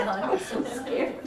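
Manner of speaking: high, breathy laughing through the words. Real, not acted. Teacher tells students how scared she was, laughing. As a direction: laugh while admitting the fear.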